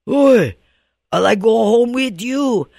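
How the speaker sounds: high, loud ghostly call; the severed head calls out, menacing and eerie. Retold or imitated. The burned head speaking to Pilikana, loud high ghostly call. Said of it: "And the head tell".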